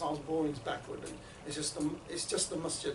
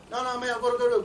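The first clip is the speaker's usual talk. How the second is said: raised, louder brush-off; acted hurried refusal; 5 semitones higher, 6 dB louder, faster than usual. Rushing man refusing the charity chat, acted brush-off. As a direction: wave off the pitch in a hurry.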